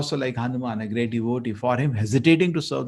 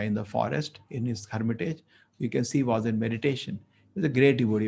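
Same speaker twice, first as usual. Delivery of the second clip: ordinary delivery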